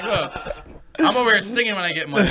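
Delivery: loud, fast and laughing; mock indignant. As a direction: rattle it off in mock protest. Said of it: "It's like".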